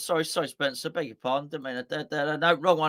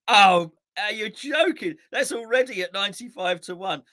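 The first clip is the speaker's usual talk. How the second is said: amazed; 4 semitones higher, 5 dB louder than usual. Real, not acted. Huge odds appear on bet.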